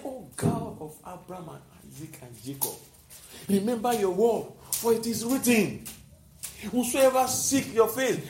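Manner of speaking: soft, hushed prayer to God; quiet prayer. Shown as a demonstration. Preacher modelling a quiet prayer for the congregation. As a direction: pray quietly, pleading on the scripture.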